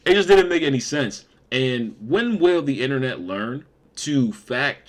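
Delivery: loud, high exasperated cry; exasperated outburst. Real, not acted. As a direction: throw up your hands, loud and high. Exasperated repeat, much louder.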